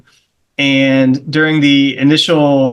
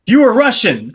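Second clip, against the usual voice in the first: high-pitched, emphatic accusing declaration; mock accusation; 8 semitones higher, 4 dB louder, slower than usual. Stranger points at him and declares his nationality, mock accusing.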